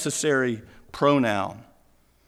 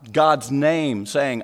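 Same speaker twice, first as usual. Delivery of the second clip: ordinary talk, nothing marked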